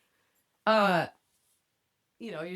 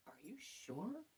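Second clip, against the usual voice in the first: imitating a stunned man, very soft; hushed stunned question; 20 dB quieter, slower than usual. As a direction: ask it barely audibly, as if gone pale. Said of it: "he just went pale".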